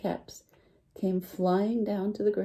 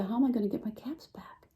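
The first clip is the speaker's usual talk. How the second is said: whisper; 4 semitones higher, 6 dB quieter than usual. Acted. Peddler wondering to himself.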